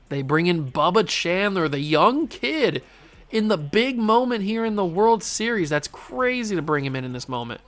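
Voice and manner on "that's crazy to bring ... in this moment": ordinary delivery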